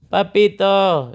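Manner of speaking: long plaintive night call; child calling papito. Retold or imitated. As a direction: call out sleepily for daddy. Son calling him at night.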